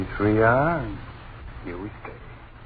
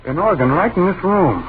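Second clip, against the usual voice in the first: alarmed; 8 semitones higher, 10 dB louder than usual. Acted. Music plays from nowhere.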